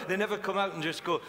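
ordinary talk, nothing marked

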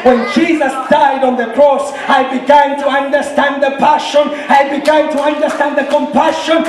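rising, fervent sermon voice; impassioned preaching. Real, not acted. Preacher testifying about the cross, fervent and building. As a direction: build with urgent conviction to a peak.

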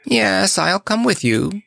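ordinary delivery, nothing marked

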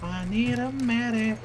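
slow, stretched "I need a medic"; drawn-out call. Real, not acted. Player asks team for a medic, drawn out. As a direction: drag out "need" and "medic" slowly.

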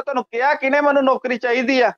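ordinary talk

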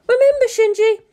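high woman's voice over the radio link; Misato voice. A narrator's reading. Misato over the comm link, steady high voice. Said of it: "Misato spoke over the link".

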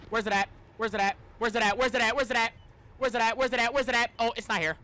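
normal talking voice

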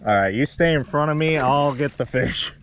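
ordinary talk

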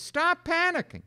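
high, loud Stop panicking; loud command. Retold or imitated. Preacher quoting his friend, high and loud. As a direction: call it out firmly. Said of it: "He says".

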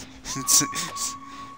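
airless wheeze of a laugh; wheezy laugh; breathy laugh. Real, not acted. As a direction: silent wheezing giggle. Laughs after teammate hit.

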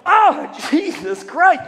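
loud, high, drawn-out mock curse; hammed-up slow pained oath; acted cry of pain. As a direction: cry out as if you just whacked yourself. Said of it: "when people whack themselves, they always go".

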